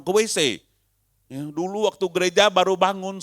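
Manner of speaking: normal talking voice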